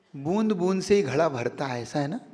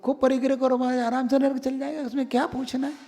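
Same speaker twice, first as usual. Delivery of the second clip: higher, softly dismissive listener imitation; glib mock answer; 5 semitones higher, 4 dB quieter, faster than usual. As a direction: lightly play a know-it-all devotee, pitched up.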